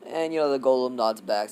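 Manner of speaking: usual speaking voice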